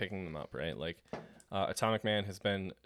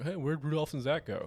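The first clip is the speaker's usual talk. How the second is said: innocent; 5 semitones higher than usual. Retold or imitated. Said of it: "no animosity, no suspicion whatsoever".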